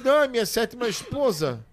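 high-pitched retold old man's reply; lightly acted old husband; imitated little old man. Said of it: "Aí o senhorzinho disse".

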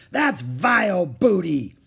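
loud, slow, disgusted pirate; roaring pirate. A narrator's reading. Captain Bones judging the book, disgusted roar. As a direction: spit out each word slowly. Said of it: "roared Captain Bones".

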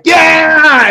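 high, loud shouted cheer; kids cheering. Acted. Kids answering dad's party-time call, excited cheer. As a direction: imitate excited kids yelling back. Voicing the kids' cheering reply.

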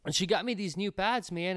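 ordinary talk

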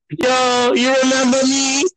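high, drawn-out mock relative's greeting; mimicked relative. Relative greeting a grown-up child, high mock voice. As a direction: put on a high-pitched fussing relative.

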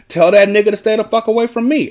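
high, loud, furious holler. A narrator's reading. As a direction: holler it across the yard. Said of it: "Annalise hollered".

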